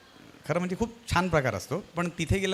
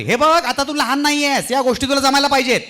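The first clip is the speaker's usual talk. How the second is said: stern mother; 10 semitones higher, 9 dB louder than usual. Retold or imitated. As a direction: scold from the other room. Mother answering from inside. (आतून मोठ्याने समजावणारी आई)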